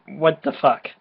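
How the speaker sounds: usual speaking voice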